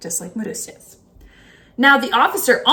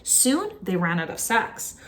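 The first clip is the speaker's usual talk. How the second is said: usual speaking voice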